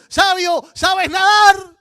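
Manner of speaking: high, loud, drawn-out shout over the storm; shouted question. Acted. Ignorant man shouting to the wise man in the storm, high and loud. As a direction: yell it across a sinking boat. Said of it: "le grita al sabio".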